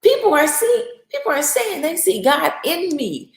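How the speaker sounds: high-pitched, impassioned appeal; pleading protest. Acted. Believer questioning the Lord over hardship, high pleading protest. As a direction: argue your case upward, voice lifted high. Acted pleading complaint to God.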